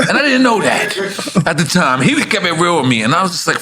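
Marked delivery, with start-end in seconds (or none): none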